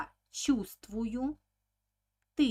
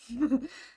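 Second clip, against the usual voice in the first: short laugh; 4 semitones higher, 6 dB louder than usual. Real, not acted. Laughs at own envious quote.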